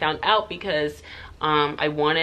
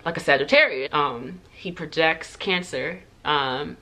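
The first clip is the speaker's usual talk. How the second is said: normal talking voice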